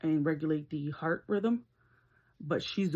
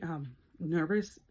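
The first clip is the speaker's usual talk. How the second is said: usual speaking voice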